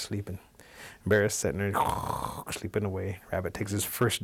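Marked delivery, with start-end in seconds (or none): 1.8-2.4 rough voiceless snore; storyteller's mock snoring; imitated bear snore.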